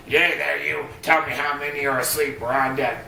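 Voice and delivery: barking drill sergeant orders; lightly voiced boot camp drill instructor. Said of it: "enjoyed my drill instructor voice".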